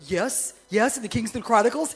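excitedly agreeing with the piper. Retold or imitated. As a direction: jump in with excitement. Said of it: "I got excited".

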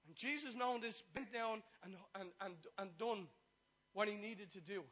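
rising preacher's fervour; fervent preaching. Acted. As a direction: build it up for the congregation. Preaching at full force.

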